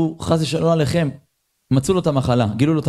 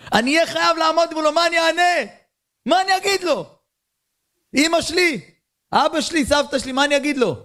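much higher, pleading and frantic; desperate plea; 10 semitones higher than usual. Retold or imitated. Dying man to God, frantic high-pitched plea. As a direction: cry out like a man out of excuses.

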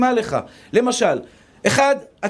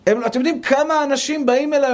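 ordinary talk

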